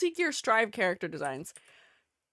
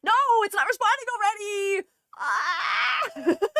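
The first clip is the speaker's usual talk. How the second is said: higher, louder cry of dismay; despairing yelp as it freezes; 9 semitones higher, 3 dB louder than usual. Real, not acted. Program freezes on launch.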